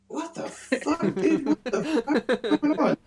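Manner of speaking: baffled and cracking up, voice way up high; incredulous reaction. Retold or imitated. His own stunned reaction, acted laughing.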